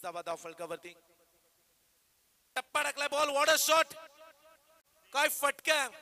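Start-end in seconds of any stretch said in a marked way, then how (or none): 2.6-5.9 high, loud commentary shout for a six; excited shout. Real, not acted. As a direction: yell it out as the ball clears the rope.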